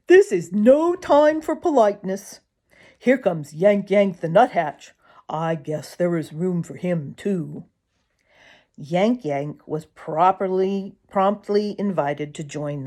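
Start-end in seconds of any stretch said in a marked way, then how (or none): 0.1-7.7 woodpecker voice. Acted. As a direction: talk like a hungry woodpecker. Drummer keeps talking.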